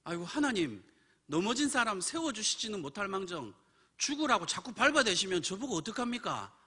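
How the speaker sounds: indignant, aggrieved lament; acted complaint. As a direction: plead your grievance in a worked-up retelling. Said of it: "제가 불평에 나오는데".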